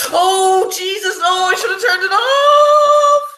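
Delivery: high, slow, wailing cry of dismay; horrified wail. Real, not acted. Reacting to the clip she wishes she'd stopped, wailing. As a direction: cry out in real distress, almost singing it.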